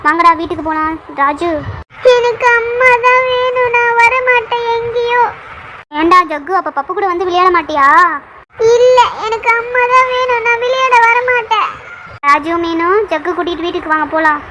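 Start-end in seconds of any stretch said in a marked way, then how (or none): 2.2-5.3 put-on high, slow toddler wail, stubbornly refusing; crying child. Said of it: "ஏன்டா இப்படி அழுவுற". 8.8-11.7 put-on crying child. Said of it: "ஏன்டா இப்படி அழுவுற".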